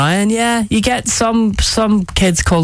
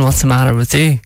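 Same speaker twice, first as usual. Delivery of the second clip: ordinary talk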